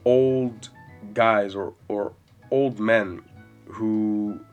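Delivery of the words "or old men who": ordinary talk, nothing marked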